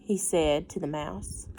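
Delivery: ordinary delivery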